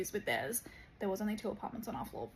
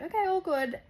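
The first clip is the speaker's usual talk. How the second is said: high, louder chirpy brush-off; bright carefree dismissal; breezy okay; 9 semitones higher, 7 dB louder than usual. Retold or imitated. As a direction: chirp it back carelessly. Brushing off the host's instructions.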